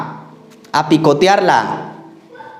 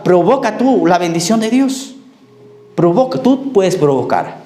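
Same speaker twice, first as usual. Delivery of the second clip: rising, higher fervent exhortation; preaching; 4 semitones higher than usual. Acted. Pastor urging the church to seek God, fervent preaching. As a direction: urge the congregation on, pitched high.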